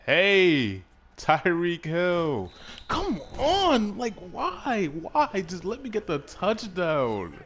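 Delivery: loud high-pitched frustrated yell; outraged yelling. Real, not acted. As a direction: yell at the screen in disbelief. Outraged at fumble in game.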